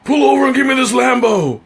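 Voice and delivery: high mock shout; shouted demand. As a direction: bark it like a carjacker. Acted demand in imagined sketch.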